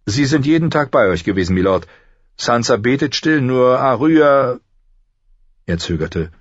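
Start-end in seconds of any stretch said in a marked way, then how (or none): 0.0-4.6 higher-pitched, earnest report; mildly raised attendant's voice; steward reporting. A narrator's reading. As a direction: voice the concerned steward a little higher. Steward reports to injured lord.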